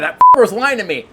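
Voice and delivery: loud, high retold outrage; acted indignation. Buyer thinking salesman lied to him, loud indignant outburst. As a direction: let the accusation burst out loudly.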